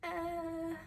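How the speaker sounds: high-pitched, baffled "uh"; baffled reaction. Retold or imitated. Vlogger reacting to a maths pickup line, baffled. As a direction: react as if completely stumped. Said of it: "I was just a bit like".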